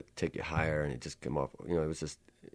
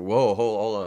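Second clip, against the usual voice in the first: loud whoa, holding them back; 4 semitones higher, 9 dB louder, slower than usual. Retold or imitated. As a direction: call out to stop them. Stopping the producer.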